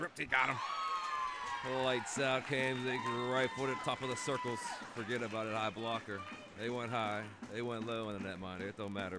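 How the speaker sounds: loud, high roaring goal call; excited goal call. Real, not acted. Commentator roaring a goal to viewers, loud and thrilled. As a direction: erupt as the puck goes in.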